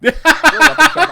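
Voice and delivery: loud, high, drawn-out call. Acted. Player proclaiming arrival at the weapon shop, loud drawn-out call. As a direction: announce it like a shop sign, slow and loud. Calling out the shop's name.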